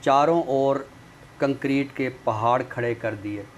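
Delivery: measured, paced reading of a prose poem; poem recital. Acted. Poet reads his prose poem to the viewers, measured recital. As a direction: recite each line with weight and long pauses.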